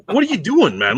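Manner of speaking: mock-exasperated question to a coworker; incredulous retort. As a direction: call out a coworker in disbelief. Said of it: "and I'm like".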